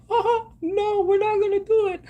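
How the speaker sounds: mocking Disney. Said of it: "And what did Disney say?".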